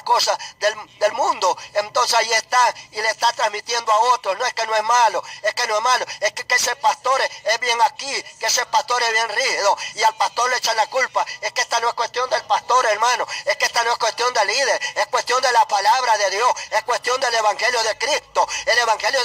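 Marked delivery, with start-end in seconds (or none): none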